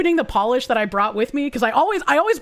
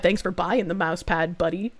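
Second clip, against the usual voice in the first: lower, softer dude voice; bro voice; 7 semitones lower, 5 dB quieter than usual. Pretend. Thanking a viewer for the mouse pad, low bro voice. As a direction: thank him like a laid-back bro.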